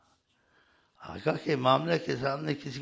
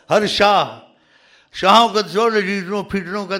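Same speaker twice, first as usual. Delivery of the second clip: loud, raised preaching burst; emphatic exclamation; 4 semitones higher, 7 dB louder than usual. Real, not acted. Preacher dismissing kings before the master, loud and emphatic. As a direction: throw it out loud and high.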